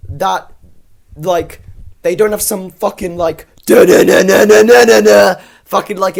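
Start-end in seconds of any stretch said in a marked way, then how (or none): 3.7-5.4 loud sung-out "da-na-na" score imitation; mock intense music. Mocking the score such a reveal could have, mock intense music. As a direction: blare it out like dramatic soundtrack music. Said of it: "intense music when he's explaining".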